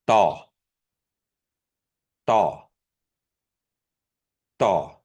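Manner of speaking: Daw with a tight unaspirated t-like d; bracketed D. Shown as a demonstration. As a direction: Daw said between t and d. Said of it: "the bracketed or bracketed D".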